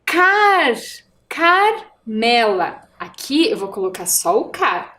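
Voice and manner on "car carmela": "Car" held long, then "Carmela" slowly broken after Car; sounded-out syllable. Shown as a demonstration. Teacher isolating the first syllable for pupils, "Car" drawn out. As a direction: stretch "Car", then say "Carmela" in slow pieces.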